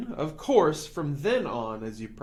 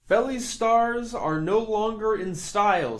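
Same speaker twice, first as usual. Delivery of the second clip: salesman's announcement; 5 semitones higher, 5 dB louder than usual. A narrator's reading. McBean announcing a new fad.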